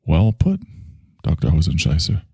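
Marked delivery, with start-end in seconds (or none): none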